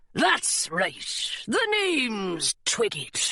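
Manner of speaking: slow pirate drawl; drawn-out, put-on sailor voice; pirate deckhand. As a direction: introduce yourself as a salty pirate mate. Pirate mate introduces himself.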